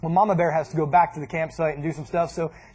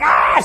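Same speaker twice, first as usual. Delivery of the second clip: loud mock scream; imitated struggling scream; 7 dB louder than usual. Mom pulling the stick from the dog, mock scream. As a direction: cry out while tugging against the dog. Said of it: "She's like".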